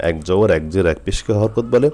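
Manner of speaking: ordinary talk, nothing marked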